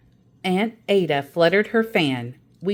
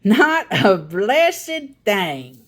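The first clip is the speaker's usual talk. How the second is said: put-on drawn-out, louder old aunt teasing; Aunt Ada's slow punchline; 3 semitones higher, 5 dB louder, much slower than usual. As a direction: stretch it out to tease the kids.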